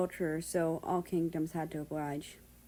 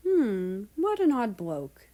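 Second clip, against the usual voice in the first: light, higher British remark; amused Brit; 4 semitones higher, 6 dB louder, slower than usual. Acted. Britain calmly comments on the departing king. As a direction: toss it off with mild amusement.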